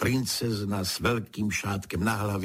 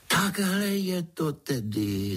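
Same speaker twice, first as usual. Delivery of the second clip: higher-pitched, delighted realization; rejoicing; 10 semitones higher than usual. A narrator's reading. Pedro finds the horn gone, rejoicing. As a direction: lift it with storybook glee. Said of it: "zaradoval se Pedro".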